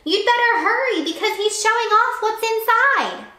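high, loud hurried warning; a high loud call of alarm; urgent shout. Acted. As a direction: urge her to hurry by shouting.

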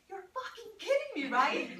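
high, quick squeal of disbelief; mock screaming in disbelief, not at full volume; retold scream. Wife on Fifth Avenue answers husband's call, mock screaming. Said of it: "screaming from the top of my lungs".

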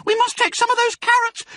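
put-on squeaky-high, insistent child voice; smallest fox cub. A narrator's reading. Smallest fox urges Dad to take carrots, eagerly. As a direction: plead like an eager little one. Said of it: "said the smallest of the three small foxes".

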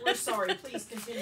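laughing quote. Retold or imitated. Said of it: "I'm like".

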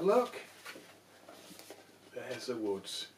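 usual speaking voice

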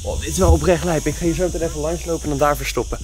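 ordinary delivery

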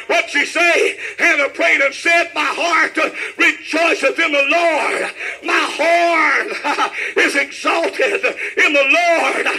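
driving, shouted revival cadence; full-force preaching. Real, not acted. Preacher promising Hannah a song for weeping, shouted preaching. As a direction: hammer out each phrase, stretching its last word.

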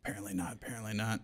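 real deflated answer under the breath; resigned muttering. Real, not acted. Asked if in-laws know he works there, resigned mutter.

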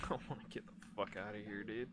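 fast hushed nervous words; scared rush. Real, not acted. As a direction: hurry it out in fear.

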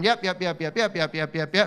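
rapid repeated little yaps; yapping little demon. Retold or imitated. Preacher mimicking a little demon interrupting Jesus, yapping. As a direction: imitate a monkey-like demon's yapping. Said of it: "this little demon appeared like a little monkey".

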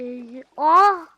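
sudden loud high cry of discovery; surprised shout. Real, not acted. Spotting a village, shouting in surprise. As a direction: yell out as you spot it.

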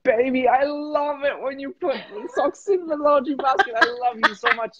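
high, gushing wife praising her husband. Retold or imitated. The wife praises her husband for the socks, high and gushing. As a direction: coo it like a doting wife. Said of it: "She would go".

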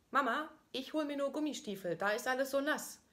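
high, lightly voiced little girl calling; child calling. Daughter calling up to mama, lightly voiced child. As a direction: call out like a small girl from downstairs. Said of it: "höre ich sie rufen".

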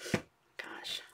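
breathy whispered gosh. Real, not acted. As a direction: whisper it under your breath. Whispered exclamation.

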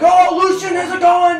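loud, high gushing relative; loud family greeting. Retold or imitated. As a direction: greet him like an excited aunt.

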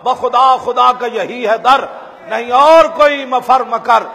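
impassioned recital of a devotional couplet; declaimed poem. Acted. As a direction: thunder out the closing verse. Closing couplet declaimed loudly.

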